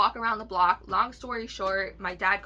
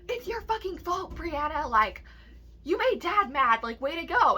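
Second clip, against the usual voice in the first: high, loud, angry blaming; hammed-up furious sister snapping at her; imitating the sister's high, loud tirade; 8 semitones higher, 4 dB louder than usual. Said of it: "of course she's mad".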